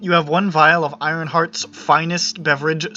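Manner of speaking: ordinary delivery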